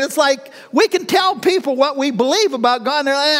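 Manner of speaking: ordinary talk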